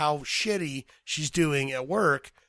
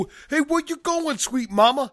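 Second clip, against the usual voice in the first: sleazy street catcall, pitched way up; catcaller; 10 semitones higher than usual. Acted. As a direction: holler playfully at a passing woman. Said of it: "his job is to catcall ladies".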